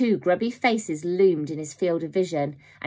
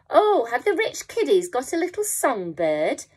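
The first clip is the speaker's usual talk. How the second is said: higher, sing-song mockery of the rich kids; mocking tone; 7 semitones higher, 3 dB louder than usual. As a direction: taunt them like spoiled children. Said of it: "she asked with a mocking tone".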